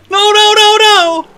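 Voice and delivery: panicked laughing yell; being chased. Real, not acted. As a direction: shriek no while fleeing. Bear chasing him.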